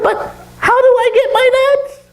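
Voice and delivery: very high squeaky monkey voice; anxious falsetto monkey question; puzzled monkey. Acted. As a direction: squeal it as a fretful little monkey. Monkey asks owl.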